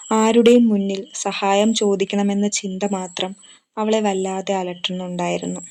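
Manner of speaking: ordinary delivery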